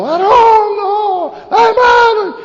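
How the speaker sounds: high, loud panicked yelling; frantic shouting. Retold or imitated. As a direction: scream it across the farm in alarm. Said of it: "salió corriendo gritando". (el pastor gritando con pánico, agudo y fuerte)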